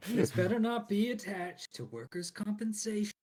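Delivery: loud, high, gruff NPC challenge; suspicious mine boss. Acted. Mine boss grilling the party about workers' comp, loud and high. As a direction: bark it like a wary mine boss. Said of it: "that's the guy asking".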